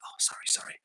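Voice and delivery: imitating mum's whispered sorry; whispered apology. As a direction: breathe it out like a mum caught interrupting. Mum apologising during live stream.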